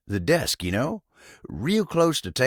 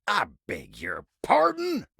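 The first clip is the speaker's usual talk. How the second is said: high, affronted question; indignant; 8 semitones higher than usual. Acted. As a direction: leap up in pitch on "pardon". Takes offence at a remark.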